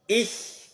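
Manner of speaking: Ich very high and loud; stressed ich. Shown as a demonstration. Teacher models the right Ich, high and loud. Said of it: "Ce n'est pas ich".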